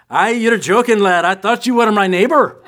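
playful imitated Scottish accent. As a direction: ham up a Scots barman's joke. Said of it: "they do the Scottish accent really well".

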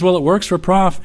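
sheepish defense. Retold or imitated. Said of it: "He says".

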